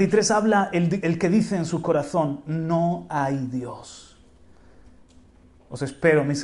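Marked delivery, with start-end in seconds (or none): 2.5-4.2 slow drawn-out. Acted. Quoting the fool's words.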